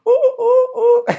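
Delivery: very high, slow, drawn-out dog howl; moaning dog impression. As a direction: whine like a sad moaning dog. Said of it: "[name] being the dog".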